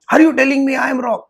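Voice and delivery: offended teacher pressing the student; indignant accusation. Retold or imitated. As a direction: throw the question back at him.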